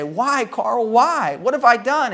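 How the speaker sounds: pleading wife. Retold or imitated. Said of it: "Ruby began to cry and scream".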